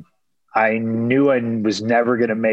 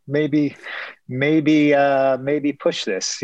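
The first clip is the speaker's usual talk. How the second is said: higher, slow, drawn-out pondering; mock hesitation; 5 semitones higher, much slower than usual. Fighter imagining postponing an out-of-town fight, mock hesitation. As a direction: stretch each maybe as if weighing it.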